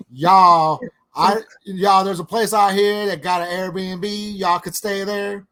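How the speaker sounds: hammed-up Southern waitress calling out to customers; mock Southern accent. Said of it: "they're talking with a Southern accent".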